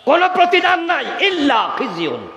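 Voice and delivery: very high call, falling at the end; cried-out preaching. Real, not acted. Preacher warns of no reward, crying out. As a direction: cry it out high, then come down.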